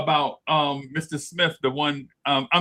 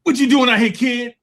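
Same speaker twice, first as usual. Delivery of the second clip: teacher voice; 9 semitones higher, 8 dB louder than usual. Retold or imitated. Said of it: "He like".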